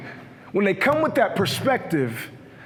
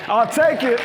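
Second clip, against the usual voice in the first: loud exclaim; 3 semitones higher, 4 dB louder than usual. Real, not acted.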